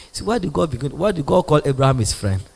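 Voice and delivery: ordinary talk, nothing marked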